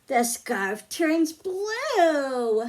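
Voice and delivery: ordinary talk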